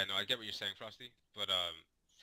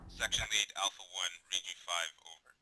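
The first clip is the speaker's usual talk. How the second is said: clipped radio call, louder; crackly military radio; 7 dB louder than usual. Shown as a demonstration. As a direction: bark it like a radio report. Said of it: "they'll say".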